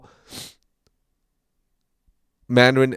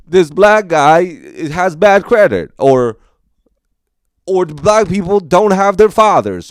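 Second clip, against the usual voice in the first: mocking comic; 7 semitones higher, 7 dB louder than usual. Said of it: "or like".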